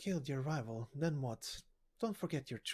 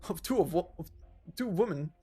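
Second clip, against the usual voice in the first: frightened pleading; 5 semitones higher, 4 dB louder than usual. Acted. Frightened soldier breaking down.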